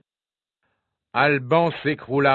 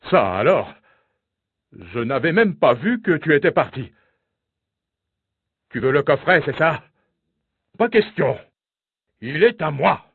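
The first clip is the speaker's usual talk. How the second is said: indignant king, pitched high on the refusal; grumbling king; 4 semitones higher than usual. A narrator's reading. King refuses his daughter the casket, grumbling and indignant. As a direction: build to a high, possessive refusal. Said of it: "grogna le roi".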